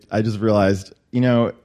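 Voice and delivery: ordinary talk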